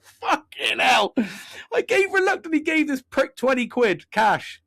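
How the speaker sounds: very high-pitched exasperated outburst; incredulous rant. Retold or imitated. Man complaining about paying twenty quid, incredulous and outraged. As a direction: voice shoots up in comic outrage.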